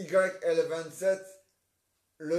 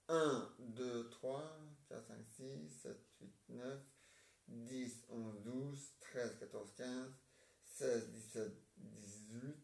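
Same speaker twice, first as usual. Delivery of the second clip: counting quietly under the breath, much softer; muttered counting; 5 semitones lower, 14 dB quieter, slower than usual. Real, not acted. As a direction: mumble the numbers to yourself, softly. Child counts fingers under breath.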